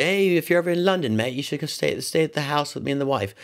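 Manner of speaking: hint of a cheery London "mate"; British accent. Quoting Roger's invite in a light British accent.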